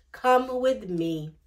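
ordinary delivery, nothing marked

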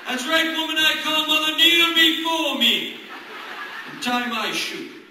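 slow, loud imperious command; commanding like a tyrant king; ancient rasping undead king. Acted. Toddler as emperor.

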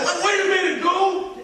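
mock call to stop; guy calling him back. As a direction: call after him from across the room.

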